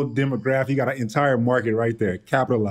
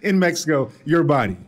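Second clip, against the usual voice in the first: teasing hype; 6 semitones higher than usual. Acted. Teasing mock shout at Brian.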